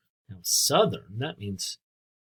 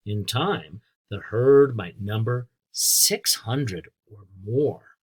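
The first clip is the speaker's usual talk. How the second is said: clear, louder storybook reading; reading to children; 5 dB louder, slower than usual. A narrator's reading. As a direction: read it out for a class of kids. Teacher reads book to children.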